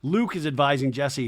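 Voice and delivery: normal talking voice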